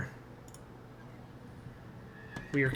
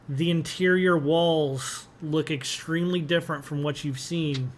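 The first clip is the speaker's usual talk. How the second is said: usual speaking voice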